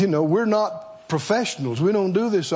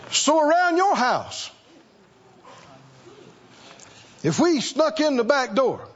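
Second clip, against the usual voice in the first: higher, probing preacher's questions with long pauses; preaching; 9 semitones higher than usual. Acted. Preacher quizzing the church about their houses, probing. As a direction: tease the congregation about their homes.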